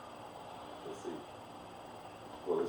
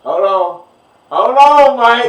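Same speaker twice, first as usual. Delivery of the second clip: teasing shout; 9 semitones higher, 13 dB louder, much slower than usual. Real, not acted. Teasing about the old comedy special.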